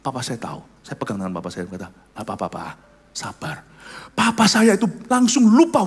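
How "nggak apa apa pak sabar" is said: soft, low reassurance; calming. Retold or imitated. Son holding his angry father's hand, soft calming words. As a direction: gently settle him down.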